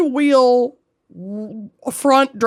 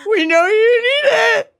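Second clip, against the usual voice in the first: laugh-crying; 9 semitones higher than usual. Real, not acted. Laughing while joking about content.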